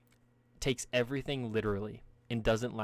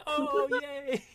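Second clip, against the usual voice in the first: sarcastic cheer; 4 dB louder than usual. Retold or imitated. Bar crowd cheering sarcastically.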